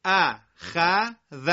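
«ل، ک» as two separate loud syllables; syllables split apart. Shown as a demonstration. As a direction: break the word into its beats for the class. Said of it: "به صورت تفکیک میخونم".

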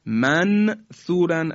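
«منثوراً» with a short, clearly sounded n; plain distinct n in «منثوراً», no long hum; izhar. Shown as a demonstration. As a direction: finish the noon of «منثوراً» before starting the ث. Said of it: "اگر بگیم اظهار کردیم".

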